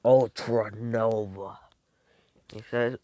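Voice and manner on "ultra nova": slow, drawn-out attack name; attack-name call. Acted. Fighter unleashing the ultranova, slow dramatic call. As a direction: intone the move, stretching each syllable. Character calls out his move.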